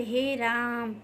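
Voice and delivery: "हे राम" with the vowels stretched long; drawn-out vowel. Shown as a demonstration. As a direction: drag out "राम" like a sigh of appeal. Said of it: "ज़्यादा खींच के बोला जाता है".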